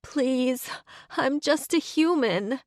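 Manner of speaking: high whimpering plea; whiny tearful plea. Pretend. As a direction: beg pitifully, close to tears. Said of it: "add tears and a whiny note to my voice".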